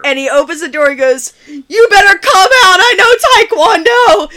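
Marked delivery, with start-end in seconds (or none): 1.5-4.3 very high, loud cracking squeal; mock tough threat cracking into a squeak; pubescent voice breaking. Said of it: "breaking voice there of the puberty".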